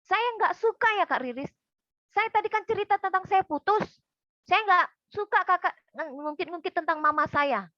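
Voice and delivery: loud, high-pitched outburst; angry shout. Retold or imitated. Client objects to counselor bringing up mama, angry shouting. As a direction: imitate a client suddenly yelling in protest. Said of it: "tiba-tiba dia teriak, dia marah".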